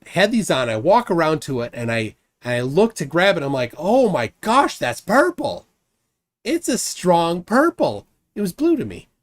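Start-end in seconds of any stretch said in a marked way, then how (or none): 3.8-8.1 re-enacted amazement, high and drawn out; amazed discovery. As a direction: gasp out the surprise, pitched up and stretched. Re-enacts his amazed first look.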